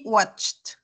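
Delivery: watched said as "watcht", one syllable; T ending. Shown as a demonstration. Teacher gives example watched, -ed as T. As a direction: end watched on a released T. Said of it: "se va a pronunciar como T".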